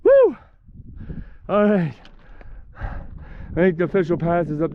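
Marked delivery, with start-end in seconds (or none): 0.0-0.4 loud high triumphant whoop; whooping cheer. Real, not acted. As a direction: shout it out like you made the summit. Cheer on reaching the cairn.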